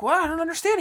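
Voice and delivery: high-pitched mock bewilderment; clueless. Retelling his reply to her anger, high and mock clueless. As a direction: imitate a man who just doesn't get it. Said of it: "he's being so clueless".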